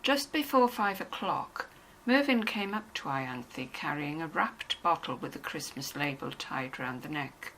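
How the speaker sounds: usual speaking voice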